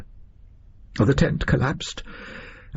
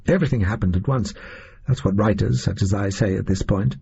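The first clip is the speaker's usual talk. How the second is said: ordinary delivery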